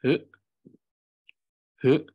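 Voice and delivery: H with too much focus, like hut; overstressed H sound; wrong hard h. Shown as a demonstration. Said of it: "might sound as hut".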